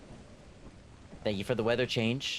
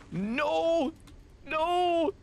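high panicked no. Real, not acted. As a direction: cry out in panic. In danger in game.

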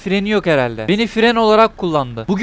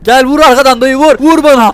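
higher, loud dare to another driver; raised, urging shout; loud goading; 5 semitones higher, 8 dB louder than usual. Real, not acted. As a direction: egg them on at the top of your voice. Daring the driver to ram him.

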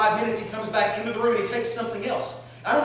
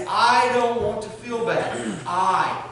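whiny want; 3 semitones higher, 7 dB louder, much slower than usual. Retold or imitated. Selfish heart voiced.

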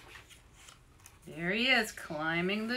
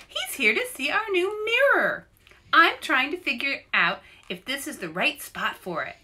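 bird mother voice; 7 semitones higher, 5 dB louder than usual. Acted.

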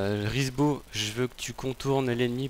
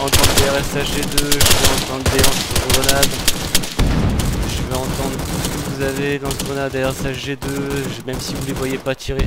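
loud commands over battle noise; shouting over gunfire; 2 semitones higher, 10 dB louder than usual. Real, not acted. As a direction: shout to be heard over the shooting. Commandant hurle par-dessus les tirs. (crier par-dessus les tirs)

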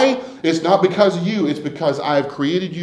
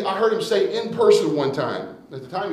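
ordinary delivery

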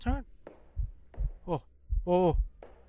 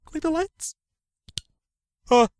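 ordinary talk, nothing marked

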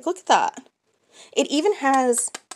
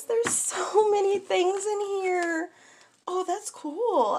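ordinary talk, nothing marked

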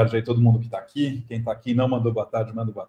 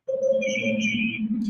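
cathedral echo; much slower than usual. Real, not acted. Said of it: "já tiramos o eco".

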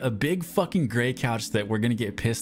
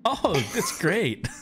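slow high reply; 8 semitones higher, much slower than usual. Pretend. Acted guest reply.

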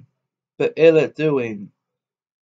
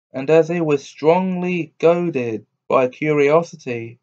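ordinary delivery, nothing marked

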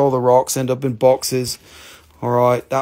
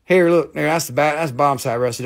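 ordinary talk

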